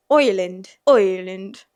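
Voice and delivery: loud "Oiland" for Ireland, faking Irish; attempted Irish accent. Shown as a demonstration. Retrying the word after slipping into English, attempted Irish accent. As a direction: push out a bad Irish "Ireland". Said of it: "I can't talk in an Irish accent".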